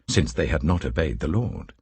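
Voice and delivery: usual speaking voice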